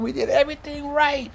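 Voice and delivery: high, pained holler. Retold or imitated. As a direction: cry it out in protest. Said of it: "I'm hollering".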